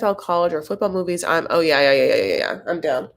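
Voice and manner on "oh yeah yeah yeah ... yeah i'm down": ordinary delivery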